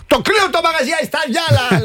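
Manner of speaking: mock gushing voice. Mocking a customer's gushing review.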